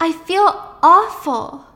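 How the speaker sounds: mock crying. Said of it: "breaking down into tears".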